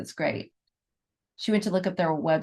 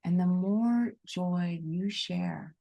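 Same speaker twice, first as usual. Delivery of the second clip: guided meditation; 5 dB quieter than usual. Acted. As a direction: breathe them into the heart, gently.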